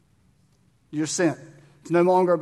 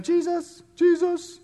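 put-on high-pitched, held-back kids' cheer; tentative practice yells; 12 semitones higher, slower than usual. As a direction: imitate shy kids trying to yell. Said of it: "there was a couple of rounds of them".

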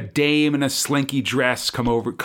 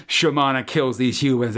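ordinary talk, nothing marked

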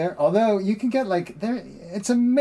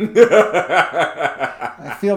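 laughing; 3 semitones higher, 7 dB louder than usual. Pretend. Mock hurt over grape jelly.

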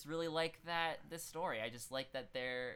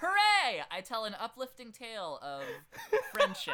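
mock cheer; 9 semitones higher, 10 dB louder than usual. Mock cheer after being told off.

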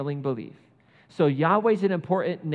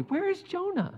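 much higher, rising puzzled "Where is Jonah?"; puzzled question; 3 dB quieter than usual. Retold or imitated. Preacher voicing the reader's baffled question, pitched high. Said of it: "you're asking the question".

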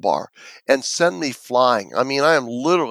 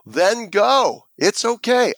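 higher-pitched retold defiance; defiant dismissal; 7 semitones higher, slower than usual. Kid telling the ambulance crew to leave, retold defiant dismissal. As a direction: send them off, re-enacting a stubborn kid.

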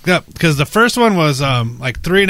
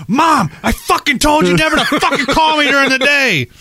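higher pitched, hammed-up irritation; mock angry rant at mom; 11 semitones higher than usual. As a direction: snap at mom as if caught off guard. Fake angry call to mom.

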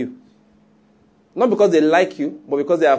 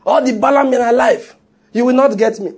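bold declaration; 7 semitones higher, 3 dB louder than usual. Acted. Preacher voices a bold prayer.

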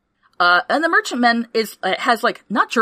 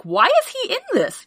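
much higher, incredulous question; baffled viewer. Retold or imitated. Imitating viewers puzzled by a good actor, high. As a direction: squeak out the disbelief.

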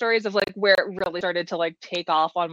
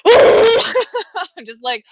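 high loud laughing slow words; 11 semitones higher, 10 dB louder, much slower than usual. Real, not acted. Laughing as she tells the hosts about the drive. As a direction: laugh through the setup, slowly. Laughing at her own story.

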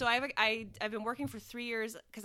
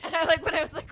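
laughing; 7 semitones higher, 15 dB louder, faster than usual. Real, not acted. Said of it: "laughing while talking".

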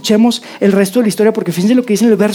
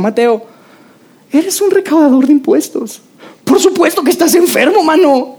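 lightly voiced Jesus, higher and playful; Jesus teasing Matthew; 6 semitones higher than usual. Jesus telling Matthew frankly he is sick, playful. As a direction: tease him like a friend, pitch up. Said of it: "le dijo".

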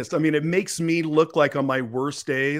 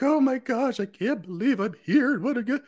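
mock panicked self; 9 semitones higher than usual. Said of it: "and you're like".

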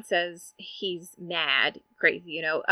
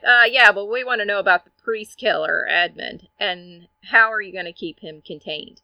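pushy reporters; 2 semitones higher, 9 dB louder than usual. Retold or imitated. Reporters pressing the doctor about a killer, pushy. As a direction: cut in loudly with a pressing question.

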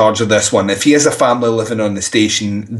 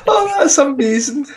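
high, helpless laughing delight; cracking up, voice pitched way up; real laughter. Real, not acted. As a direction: squeeze the praise out through laughter. Cracking up at the accent attempt.

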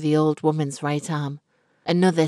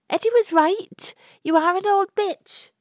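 high and a little louder, defiant insult; young woman's defiance; 3 dB louder than usual. A narrator's reading. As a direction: spit it at the old woman, high and bold. Florrie insults Missus Fortescue.

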